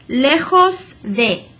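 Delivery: slow "lejos" with a throaty j like h; slow model Spanish. Shown as a demonstration.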